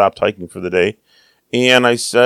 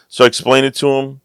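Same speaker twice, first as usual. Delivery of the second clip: ordinary talk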